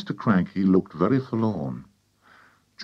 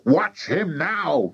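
higher, drawn-out excited cry; 7 semitones higher, much slower than usual. A narrator's reading. Mister Cranky watching the cockerel, excited cry. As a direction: cry it out, stretching the words. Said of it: "cried Mister Cranky".

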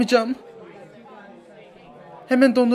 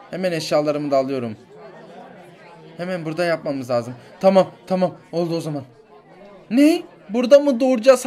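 ordinary talk

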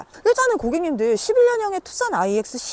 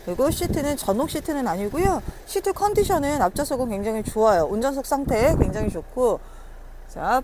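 ordinary talk, nothing marked

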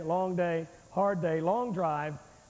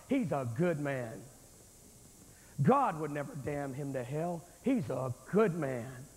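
much lower, drawn-out mock testimonial; slow, deep imitation of someone vouching for him; imitated well-meaning defender; 8 semitones lower than usual. Preacher mimicking people who excuse the unsaved, low slow mock praise. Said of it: "How many times have I heard in my life".

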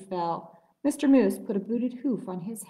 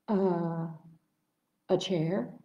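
stammering; 3 semitones lower, 5 dB quieter, slower than usual. A narrator's reading. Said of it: "stammered the turkey".

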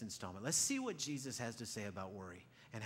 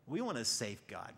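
ordinary talk

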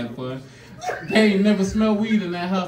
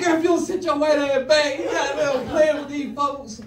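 scolding; 10 semitones higher, 4 dB louder than usual. Retold or imitated. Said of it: "I said".

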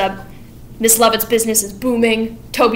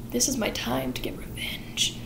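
softer, whispered scheming; sinister whisper; 12 dB quieter than usual. Retold or imitated. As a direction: hiss your plan under your breath. Sweeney plotting revenge.

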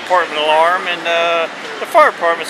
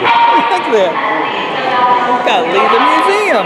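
shouting over fire alarm; 7 semitones higher than usual. Real, not acted. Evacuation with fire alarm ringing.

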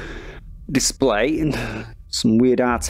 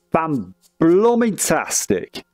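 drawn-out, higher-pitched mock delight; sarcastic; 6 semitones higher, much slower than usual. Real, not acted. VR player stuck using an Xbox controller, sarcastic. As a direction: stretch it out slowly with heavy sarcasm.

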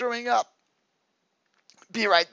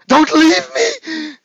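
loud, slow, hammed-up crying plea; mock wail; 12 semitones higher, 9 dB louder, much slower than usual. Hammed-up girlfriend wailing at her boyfriend not to leave.

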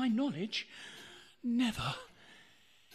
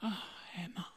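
a soft, much quieter whisper of her name; tender whisper; 5 semitones lower, 8 dB quieter, faster than usual. Acted. Husband whispering his wife's name in tenderness.

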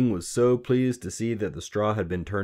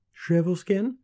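put-on high, louder questioning girl; tentative guess; 12 semitones higher, 5 dB louder than usual. A narrator's reading. Queen guessing the little man's name, tentative. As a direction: try the name out, pitched right up.

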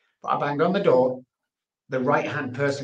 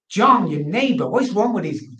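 urgent confused insistence; 7 semitones higher, 5 dB louder than usual. Retold or imitated. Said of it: "I said".

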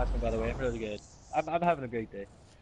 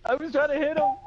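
high loud exasperated yell; frustrated shout; 4 dB louder than usual. Real, not acted. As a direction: shout it out, annoyed at losing. Killed in game.

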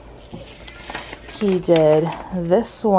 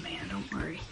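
soft reassuring whisper; 17 dB quieter than usual. Real, not acted. Comforting sick child.

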